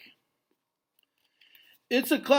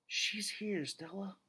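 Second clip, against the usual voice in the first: soft hushed whisper, much softer; 5 semitones higher, 11 dB quieter than usual. Acted. Gorilla whispering to his old friend Stella, hushed. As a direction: breathe the words to Stella. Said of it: "I whisper".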